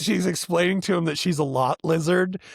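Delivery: ordinary talk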